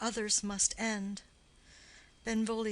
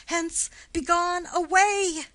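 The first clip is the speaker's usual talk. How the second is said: higher and louder, a frantic push to flee; urgent plea; 6 semitones higher, 5 dB louder than usual. Acted. Friend urges the killer to flee before the prince comes, frantic. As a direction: drive him out the door with the last word.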